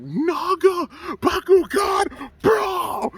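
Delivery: very high imitated anime battle cry; high battle summons. Fan re-enacts Wyvern summoning Naga, high drawn-out shout. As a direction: stretch each word in a piercing, high call.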